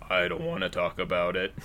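very low, soft deep-voice line; Fluttershy. Retold or imitated. Quoting pony episode to Candace, low and quiet. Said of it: "that line that Fluttershy says".

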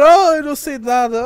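high, loud mock innocent priest. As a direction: play the priest feigning ignorance. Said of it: "e o padre". (voz de padre a fingir inocência)

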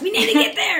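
high, loud panicked rush to be on time; mock frantic hurry. Co-host mimics the family's panic, high and loud. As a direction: play the stressed mum late for pickup.

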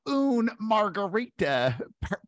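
slow, high Southern US drawl on Spanish; mock a terribly anglicized Spanish with Southern vowels; Southern-accented Spanish. Imitating Southern lady ordering at resort bar, drawled Southern-accented Spanish. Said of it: "Englishized Spanish with a Southern accent".

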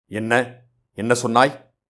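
stern, taken-aback questioning; a touch of startled severity; stern surprise. A narrator's reading. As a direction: demand it with restrained displeasure. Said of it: "முகம் கடுத்தது".

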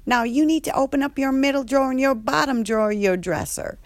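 high, loud, fast sassy scolding; Andre's voice. Retold or imitated. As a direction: staff member scolding T about hoarded food. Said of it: "And Andre's like".

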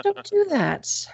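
high, slow pet-talk scolding; talking to the cat. Real, not acted. To the cat playing with the mic arm, high slow telling-off. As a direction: stretch it out up high like scolding a kitten.

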